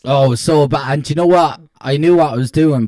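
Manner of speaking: ordinary talk, nothing marked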